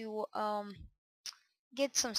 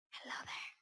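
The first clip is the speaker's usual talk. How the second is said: whispered greeting; 11 dB quieter, faster than usual. Pretend. Whispering to the spider.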